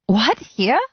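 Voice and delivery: high, loud startled squeak. A narrator's reading. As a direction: squeak it out in shock. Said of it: "I squeaked".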